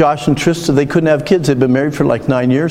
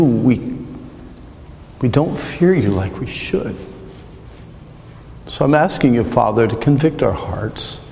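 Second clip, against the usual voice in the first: closing prayer. Acted. Pastor praying for the church at the end, earnest prayer. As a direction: pray over the congregation, humbly.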